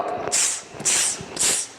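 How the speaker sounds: quiet tss, tss, tss hisses; teacher imitating stones clicking; hissing tss like stones. Shown as a demonstration. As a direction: spark noise of two stones striking. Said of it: "tá fazendo o quê".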